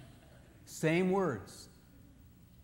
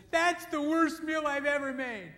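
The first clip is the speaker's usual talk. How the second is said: much higher, slow, whining wife; wife fishing for praise; 12 semitones higher, 4 dB louder than usual. Retold or imitated. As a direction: wail it like she wants comfort. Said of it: "afterwards she says".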